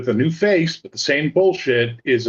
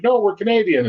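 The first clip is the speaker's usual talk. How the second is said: higher, huffy refusal; indignant Canadians; 7 semitones higher than usual. Retold or imitated. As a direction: insist proudly you're Canadian. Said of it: "They'll be like".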